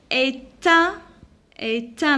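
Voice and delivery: "est un" slowly with the T sounded; "est un", T linked to the vowel; liaison. Shown as a demonstration. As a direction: split as é-tun, the t leading into un. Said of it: "la liaison entre le T et le un".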